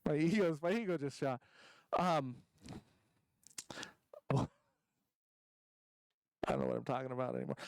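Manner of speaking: ordinary talk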